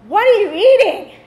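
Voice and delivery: high, loud cry of disgusted disbelief; appalled kid. Acted. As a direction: blurt it out like an appalled classmate. Kid confronts her lunch in story.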